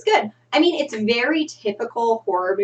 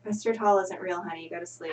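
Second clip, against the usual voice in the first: soft soothing mother; 2 semitones lower, 8 dB quieter than usual. Acted.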